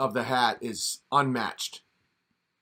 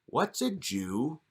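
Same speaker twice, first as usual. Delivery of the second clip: put-on slow, quiet, puzzled child; baffled kid voice; 5 dB quieter, much slower than usual. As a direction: ask it slowly and blankly like a confused kid.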